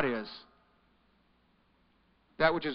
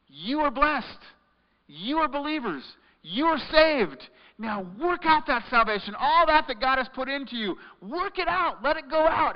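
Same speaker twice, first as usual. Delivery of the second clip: preaching at full force; 6 semitones higher than usual. Acted. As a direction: urge the church on, higher and higher. Preacher builds to climax.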